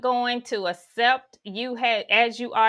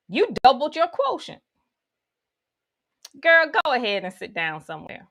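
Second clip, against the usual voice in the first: sassy dismissal; faster than usual. Acted. Mocking own inner voice.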